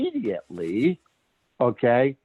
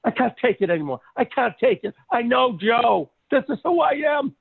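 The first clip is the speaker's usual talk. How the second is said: excited client; 11 semitones higher than usual. Retold or imitated. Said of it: "she goes".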